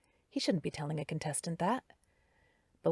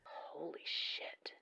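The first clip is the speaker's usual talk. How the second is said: very slow, much softer whispered gasp of shock; 13 dB quieter, much slower than usual. A narrator's reading. Carrie seeing the blood smear, shocked whisper. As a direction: breathe it out in horror.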